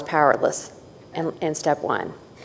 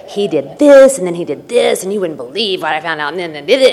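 mock indignant rant; 5 dB louder than usual. Retelling her own complaints.

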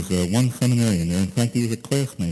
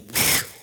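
zoom. Retold or imitated. Cars zooming past on road.

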